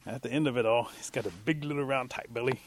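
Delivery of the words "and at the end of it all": ordinary delivery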